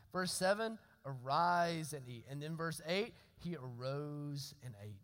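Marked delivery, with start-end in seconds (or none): 1.1-2.3 drawn-out reading. A narrator's reading. Verse repeated for emphasis. 3.4-5.0 low, slow, soft scripture reading; drawn-out verse reading. A narrator's reading. Preacher showing the congregation a repeated verse, drawn-out and hushed.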